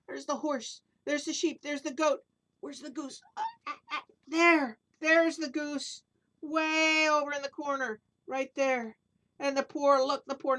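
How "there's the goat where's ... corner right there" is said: ordinary delivery, nothing marked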